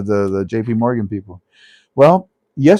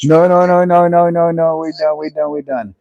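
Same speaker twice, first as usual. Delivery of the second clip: loud, high, rapid-fire string of no's; urgent cut-off; 7 semitones higher, 6 dB louder than usual. Real, not acted. Host stopping a caller's recording before the number, urgent cut-off. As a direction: cut them off loudly, easing down at the end.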